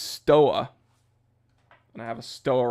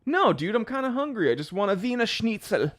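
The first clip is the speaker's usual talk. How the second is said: mock friend; 8 semitones higher than usual. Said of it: "retold friend".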